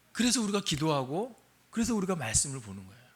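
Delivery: ordinary talk, nothing marked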